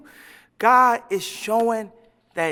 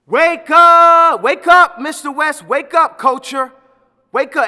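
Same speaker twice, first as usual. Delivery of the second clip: loud, higher wake-up holler; big raised-voice rallying call; 5 semitones higher, 12 dB louder, slower than usual. Acted. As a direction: shout it out to rouse a sleeper. Mock rousing call to culture.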